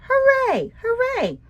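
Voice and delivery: high, loud cheer; joyful yell. Acted. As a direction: shout it out with joy like a happy frog. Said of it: "Fred the Frog yelled".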